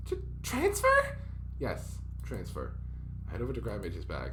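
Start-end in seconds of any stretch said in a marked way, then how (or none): 0.1-1.2 much higher, slow stammered question; shocked stammer. Acted. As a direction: stumble on it in disbelief. Shocked at leaving school.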